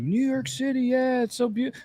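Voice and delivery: very high, mock-gushing tourist; gushing admirers. Outsiders raving about New York, high-pitched mock gush. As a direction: imitate a starry-eyed fan, voice way up. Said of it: "everybody's like".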